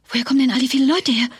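ordinary talk

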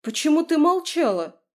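high, loud upset question; shocked reproach. Acted. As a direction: demand it, still in shock. Mother reacts to pregnancy news.

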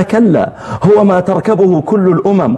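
high, strained impatient voice; exasperated questioner. Acted. Questioner in the poem, high and exasperated. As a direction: explain again, losing patience.

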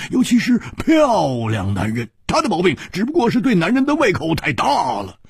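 high, animated character voice with grunts; 苦笑反驳的蝴蝶花. Acted. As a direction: argue back in a high, lively, exaggerated voice. 蝴蝶花苦笑着反驳，夸张角色腔. (蝴蝶花的高亢夸张腔，带哼哼声)

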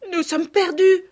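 loud, high, acted wail; bursting into tears. A narrator's reading. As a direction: cry out as a despairing girl. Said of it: "dit Sophie en éclatant en sanglots". (fillette qui éclate en sanglots)

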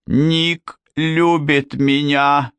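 высоко, протяжно, с нажимом на каждом слове; упрямо цепляться за каждое слово, повысив голос; упрямое шипение. A narrator's reading. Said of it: "прошипела Китти, упрямо цепляясь за свою мечту". (упрямо, сквозь шипение)

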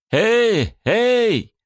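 loud high call across the street; shouting. Acted. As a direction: call out to get attention. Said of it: "прокричал Уильямс".

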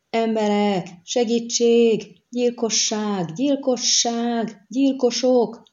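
high, drawn-out alarm calls; crying for help. A narrator's reading. Villager calling everyone after a killing, crying murder. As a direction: raise the alarm, stretching each word like a distant call. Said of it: "ordított fel valaki".

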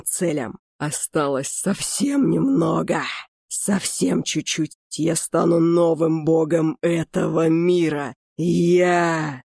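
slow, grandiose undead lich voice; a skeletal mage savoring his triumph; gloating lich. Acted. Lich gloats about becoming god.

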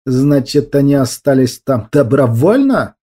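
ordinary delivery, nothing marked

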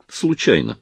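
understated dry answer; dry reply. A narrator's reading. Actor answering a question about the coat, mildly dry. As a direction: answer with a touch of dryness. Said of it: "сухо ответил Залесский".

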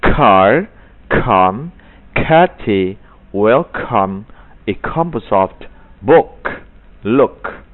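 right way, pop every k; aspirated k again. Shown as a demonstration. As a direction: correct k word list. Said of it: "Ulangi kembali dengan yang benar".

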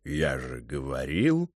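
very low, slow cat drawl; deep, lingering I-told-you-so; smug talking cat. Acted. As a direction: draw it out deep, rising at the end. Cat butts in, vindicated.

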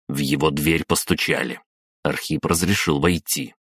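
ordinary delivery, nothing marked

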